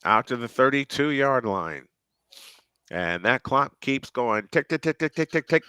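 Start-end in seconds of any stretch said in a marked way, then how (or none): none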